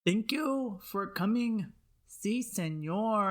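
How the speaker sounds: slow, badly faked Mexican accent; Speedy Gonzales.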